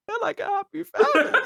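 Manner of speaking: very high squeaky weepy voice; hammed-up sentimental whimper; mock tearful. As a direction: pretend to choke up, half laughing. Acting choked up at film scene.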